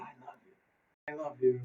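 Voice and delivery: very soft, near-whispered admission; hushed confession. Retold or imitated. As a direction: let it out barely audibly. Soldier sheepishly confesses his murmured words.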